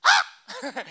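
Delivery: high piercing shriek; toddler scream answer. Retold or imitated. Little Luke screaming back at his worried mom. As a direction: answer with a child's wild scream. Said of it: "all you would hear is".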